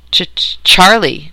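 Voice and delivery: slow nervous stammer. Acted. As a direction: stutter the name fearfully. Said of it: "Charlie stammered".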